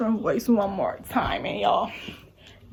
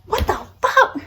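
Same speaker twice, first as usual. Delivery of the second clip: angry outburst; 7 semitones higher than usual. Retold or imitated. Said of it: "I was pissed off".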